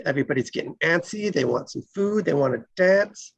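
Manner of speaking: ordinary talk, nothing marked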